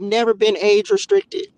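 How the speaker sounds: loud, indignant insistence. Real, not acted. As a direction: protest the restriction. Complaining about age restriction.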